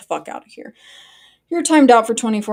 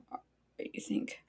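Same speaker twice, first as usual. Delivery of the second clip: breathy whispered aside; 18 dB quieter than usual. Real, not acted. Streamer whispering a quick aside to chat. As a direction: whisper it under your breath.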